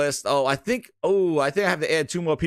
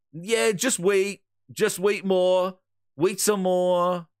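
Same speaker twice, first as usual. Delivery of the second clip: high, slow mocking voice; mocking drawl; 6 semitones higher, much slower than usual. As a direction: drag it out mockingly. Mocks company's stalling line.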